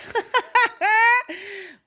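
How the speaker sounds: loud high burst of laughter; real laugh; high embarrassed laugh. Real, not acted. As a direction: laugh at your own mistake. Laughs at own slip.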